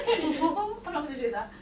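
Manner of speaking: amused, laughing refusal. Real, not acted. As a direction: giggle the line again. Laughs before repeating.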